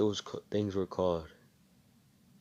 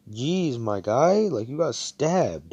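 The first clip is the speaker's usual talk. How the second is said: amazed disbelief; 6 semitones higher, 7 dB louder, slower than usual. Retold or imitated. Amazed friend questions wounded hero.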